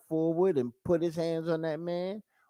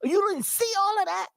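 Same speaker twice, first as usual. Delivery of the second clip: rising higher and higher, indignant, much higher by the end; a worked-up boyfriend pleading his case; incredulous, climbing to a high squeak; worked-up man; 7 semitones higher than usual. Retold or imitated. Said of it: "And the man like".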